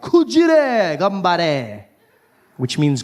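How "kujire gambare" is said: very slow drawn-out Kujire gambare; a slow hammed-up Japanese phrase; slow mock Japanese. Shown as a demonstration. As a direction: stretch out Kujire gambare carefully. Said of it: "You can say other things".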